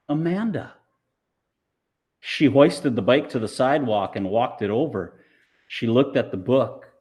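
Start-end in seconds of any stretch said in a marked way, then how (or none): none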